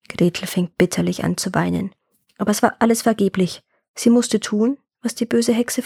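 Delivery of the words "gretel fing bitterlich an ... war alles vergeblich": ordinary delivery, nothing marked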